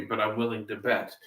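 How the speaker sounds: ordinary talk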